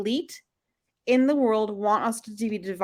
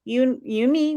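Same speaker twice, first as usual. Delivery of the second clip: ordinary delivery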